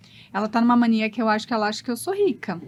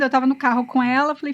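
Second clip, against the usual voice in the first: normal talking voice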